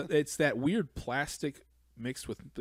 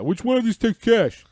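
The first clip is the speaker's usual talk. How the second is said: put-on customer voice; 10 semitones higher, 11 dB louder than usual. Clueless customer asking the cashier, hammed up. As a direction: squeak it out as the dim guy. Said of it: "And he goes".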